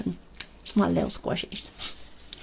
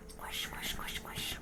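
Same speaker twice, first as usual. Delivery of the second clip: right in your ear; 16 dB quieter, much slower than usual. Real, not acted. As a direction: close ASMR whisper into mic. ASMR mic test, far whisper.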